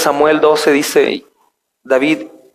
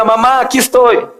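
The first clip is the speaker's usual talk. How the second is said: put-on little girl's voice; 8 semitones higher than usual. Mother voicing her daughter waking.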